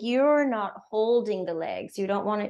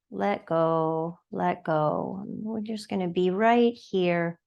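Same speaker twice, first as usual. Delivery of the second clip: unhurried, soothing yoga-class guidance; guided meditation; 2 semitones lower than usual. Acted. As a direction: guide them gently into noticing the breath. Leads breathing meditation.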